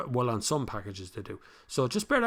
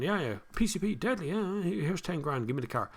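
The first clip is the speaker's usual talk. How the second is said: lightly mimicked eager buyer, starting higher; heedless car buyer; 6 semitones higher than usual. Host mocking Irish buyers rushing into finance, breezy and heedless. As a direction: brush it off like a careless customer. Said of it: "people who seem to be just going".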